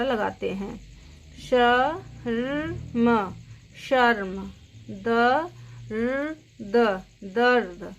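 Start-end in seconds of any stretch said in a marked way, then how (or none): 1.5-3.3 शर्म stretched very slowly, each sound drawn out; slow spelled-out word. Shown as a demonstration. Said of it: "इसकी आवाज़ रेफ की कैसे आती है". 4.9-7.0 दर्द stretched very slowly, each sound drawn out; slow spelled-out word. Shown as a demonstration. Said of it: "इसकी आवाज़ रेफ की कैसे आती है".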